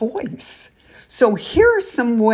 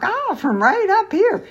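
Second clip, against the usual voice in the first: high voice; 12 semitones higher than usual. Shown as a demonstration. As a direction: jump way up high in pitch. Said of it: "but tone it down".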